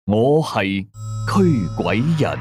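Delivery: ordinary delivery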